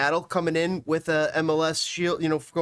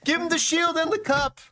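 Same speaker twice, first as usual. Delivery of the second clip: high-pitched hyped call; fired-up award call; excited proclamation; 9 semitones higher than usual. Real, not acted. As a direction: crown the champion with a high, excited cry. Hyped declaration of the winner.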